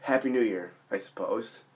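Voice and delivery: sick, hoarse voice. Real, not acted. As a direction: talk through a lost voice. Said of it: "I apologize for my voice a little bit".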